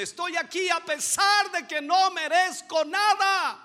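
loud, high, desperate begging; pleading shout. Retold or imitated. The woman begging Jesus, loud desperate plea. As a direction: shout it as someone who deserves nothing. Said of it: "diciendo y gritándole".

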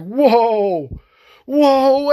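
high, loud, drawn-out whoa of shock; startled whoa. Retold or imitated. Nineteen-year-old recoiling from a stranger's offer, high startled whoa. Stranger propositions him in parking lot.

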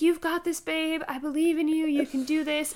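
lifted, supportive pep talk; encouraging birth coach. Retold or imitated. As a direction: urge her on through pain. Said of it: "He's, like, the best birth coach".

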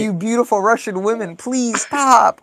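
joking bit, begging the Russian women to stop; mock victim pleading. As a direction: play the overwhelmed victim, pleading.

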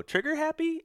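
a brother teasing about shooting too fast; incredulous question. Retold or imitated. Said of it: "And you go".

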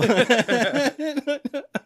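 high burst of laughter. Real, not acted. Laughing at own joke.

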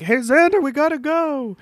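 high sing-out call. Acted. Gang calls Xander away, calling out. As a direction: call to a friend some way off.

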